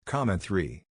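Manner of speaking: ordinary delivery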